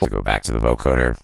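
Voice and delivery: robotic vocoder voice dropped deep; deep pitch shifted. Shown as a demonstration. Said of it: "Minus fourteen semitones".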